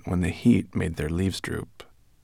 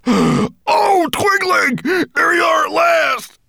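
very high, loud weeping shout; dwarf crying with relief at finding him; tearful cry; 9 dB louder, slower than usual. Acted. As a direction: call out through tears of relief. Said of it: "Large tears were trickling".

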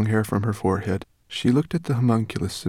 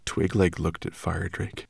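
ordinary delivery, nothing marked

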